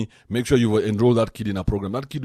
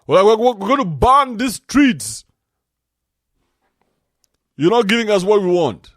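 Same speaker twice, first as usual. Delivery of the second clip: mock protester yell; 12 semitones higher, 10 dB louder than usual. Mimicking protesters in France.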